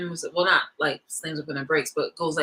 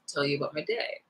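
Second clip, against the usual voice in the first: normal talking voice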